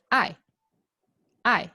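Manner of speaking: "ai" falling sharply; strong falling "ai"; fourth tone. Shown as a demonstration. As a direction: say "ai" short and emphatic. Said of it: "that's a fourth tone".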